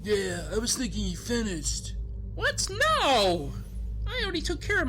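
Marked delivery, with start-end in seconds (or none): 2.3-4.9 indignant denial. Acted. Accused of finishing too early.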